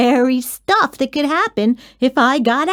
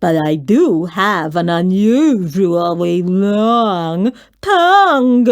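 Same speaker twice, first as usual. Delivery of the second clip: slow, slurpy monster voice, tongue rising; slurping monster boast; 3 semitones lower, 4 dB louder, slower than usual. A narrator's reading. Mac the monster brags to the child about his tongue, slow and slurpy. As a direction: drag out the word tongue like a proud slobbery beast.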